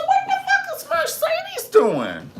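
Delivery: a shocked shriek with a laugh in it; shrieking disbelief. Real, not acted. Watching Mercedes dance, a high laughing shriek. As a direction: squeal it out high and loud, half laughing.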